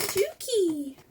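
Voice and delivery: imitating dog. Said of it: "He's like".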